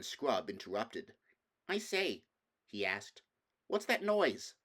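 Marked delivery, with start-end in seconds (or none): 1.7-2.2 put-on boy's voice. Boy Scrub voiced in story. 3.7-4.5 put-on boy's voice. Boy Scrub voiced in story.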